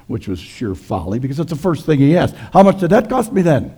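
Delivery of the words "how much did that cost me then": higher, louder pastor demanding the price; hammed-up stingy husband asking; Ole, suspicious. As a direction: Ole asks his wife what it cost, a bit higher and louder.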